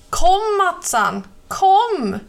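put-on boy tugging his friend's arm, begging; pleading. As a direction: beg your friend to come away. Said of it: "bönade Larsan".

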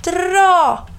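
shouting. A narrator's reading. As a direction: shout the command up high. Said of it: "Christopher Robin ropade".

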